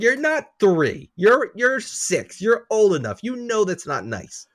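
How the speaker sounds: slow, high, emphatic scolding; slow scolding of a young son. Retold or imitated. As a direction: spell it out slowly to a six-year-old. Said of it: "It's like".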